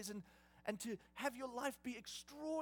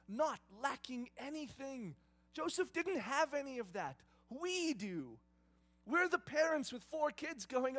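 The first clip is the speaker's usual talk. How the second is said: loud, driving preacher's delivery; preaching; 4 semitones higher, 9 dB louder than usual. Acted. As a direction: preach it to the whole room. Preaching at full force.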